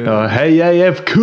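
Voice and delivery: playful Skåne dialect impression; Kristianstad accent. Co-host imitating a Kristianstad fan greeting, playful Kristianstad accent. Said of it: "där har vi precis det ljudet".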